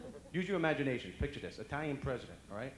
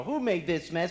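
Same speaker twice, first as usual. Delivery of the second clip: loud, raised accusing question; mock scolding; 5 semitones higher, 12 dB louder, slower than usual. Owner home to a mess, loudly scolding. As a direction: scold the pets with mock outrage. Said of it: "you come home and you go".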